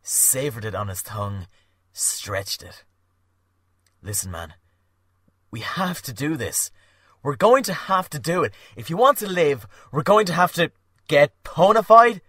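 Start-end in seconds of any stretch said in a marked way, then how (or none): 4.0-12.2 urgent plea. Acted. Dylan urging, grows loud.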